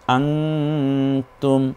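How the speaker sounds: আংতুম with the ং hummed long before তুম; ikhfa. Shown as a demonstration. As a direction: linger on the nasal in আংতুম, very slowly. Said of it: "তখন ইকফা করে পড়তে হয়".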